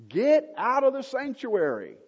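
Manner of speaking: high, loud command to get out; commanding shout. Acted. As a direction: order him out of the sanctuary at full voice. Priests drive the king out.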